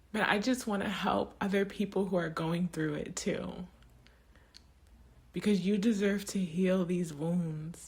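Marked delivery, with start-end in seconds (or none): none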